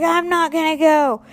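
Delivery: high, fast mock tantrum; temper tantrum. Refusing the Dream Center, mock tantrum. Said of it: "throwing a temper tantrum".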